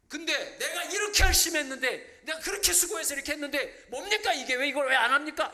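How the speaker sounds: loud, high, aggrieved protest at God; indignant complaint. Retold or imitated. Preacher mimics a believer's loud indignant complaint. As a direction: complain loudly that your effort was wasted. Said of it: "이렇게 말하는 건 뭐죠".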